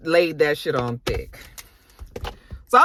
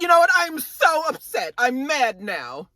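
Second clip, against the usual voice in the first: upset; 7 semitones higher than usual. Retold or imitated. Karen before walking off from the table, acted upset. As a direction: draw it out in a mock huff. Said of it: "Karen is so upset".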